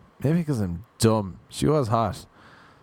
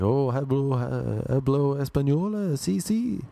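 halting fake Spanish, rising high at the end; hammed-up bad Spanish, climbing in pitch; mock Spanish attempt; 4 semitones higher, slower than usual. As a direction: imitate someone faking Spanish to butt in. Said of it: "she starts speaking in Spanish".

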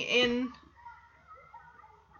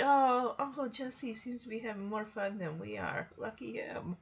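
much softer, imagined private thought; soft aside; 3 semitones lower, 10 dB quieter than usual. Retold or imitated. Recapper voices the girls watching their uncle, soft aside. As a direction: drop your volume for an envious little aside.

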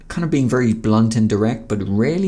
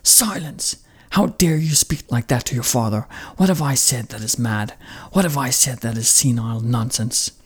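put-on furious old father; 2 semitones higher than usual. Warlord rebuking his blunt son, furious. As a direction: snap silence, then demand. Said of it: "The father responds".

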